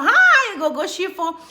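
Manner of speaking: high, loud, drawn-out hi; excited greeting. Real, not acted. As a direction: sing out a big hello to a fan. Calling out greeting to a viewer.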